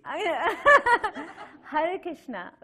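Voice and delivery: high, loud, breaking into laughter; real giggles over the words; laughing. Real, not acted. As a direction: laugh through the opening greeting. Laughs while starting the letter.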